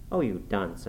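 ordinary delivery

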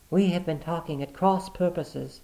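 high, light woman's reply. Acted. Sister covering with her brother, smoothly. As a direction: answer smoothly.

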